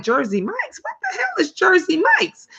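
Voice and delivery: high-pitched incredulous question; mock bafflement. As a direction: act out being totally puzzled. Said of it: "And I'm like".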